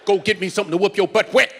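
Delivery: fast, clipped order from mama; mama sending the kid for a switch; a hint of a stern mother. Parent orders kid fetch switch.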